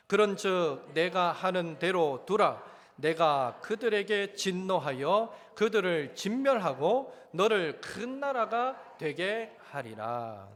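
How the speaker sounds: loud, slow, solemn Bible reading; slow, loud pulpit reading of God's words; scripture reading. Acted. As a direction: proclaim the verse slowly and loudly. Reads scripture verse aloud.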